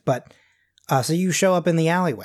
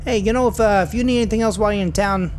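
put-on higher, louder character accent; Terry's corrected accent; 7 semitones higher, 6 dB louder than usual. Driver Terry offering help to Garth, in his proper accent, higher and louder. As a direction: push the driver's accent up, loud and chatty. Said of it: "sorry, I'm doing the wrong accent".

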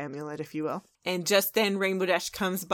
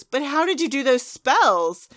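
loud, raised-pitch acted protest; exasperated demand; 6 semitones higher, 8 dB louder than usual. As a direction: demand an answer, loud and incredulous. Trixie demands to know the trick.

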